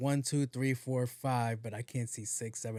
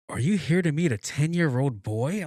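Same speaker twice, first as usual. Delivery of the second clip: put-on loud accusing old lady voice; 2 semitones higher, 7 dB louder than usual. Old lady at the window accusing him, loud.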